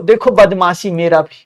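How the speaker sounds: usual speaking voice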